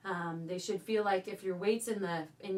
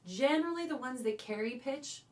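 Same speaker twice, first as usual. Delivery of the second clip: ordinary talk, nothing marked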